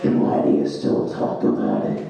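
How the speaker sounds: very low, voiceless rasping inner voice; inner monologue. Acted. Inner monologue taunting the comic about old insecurities, low raspy whisper. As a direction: needle him in a deep, whispery rasp. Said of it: "conversation with me and my inner monologue".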